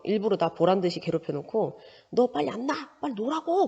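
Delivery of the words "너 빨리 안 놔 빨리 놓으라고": higher, demanding shout-like scold; mock scolding. Teacher acting a kid ordering another to let go, mock angry. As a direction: bark the demand like a kid in a fight.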